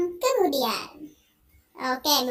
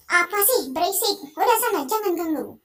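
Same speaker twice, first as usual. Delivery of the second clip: irritated higher snap; annoyed husband; 4 semitones higher, 4 dB louder than usual. Acted. Husband waving off his nagging wife, irritated snap. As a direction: brush her off, fed up.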